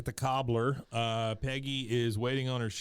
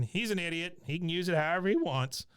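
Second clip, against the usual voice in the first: Cotton; 6 semitones higher than usual. Retold or imitated. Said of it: "we get more from Cotton".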